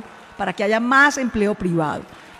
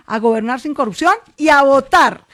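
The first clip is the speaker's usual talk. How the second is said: high, forceful campaign call; rally the crowd to go and vote; ringing stump-speech climax; rousing rally finale; 3 semitones higher, 4 dB louder than usual. Pretend. Rousing close of the requested speech.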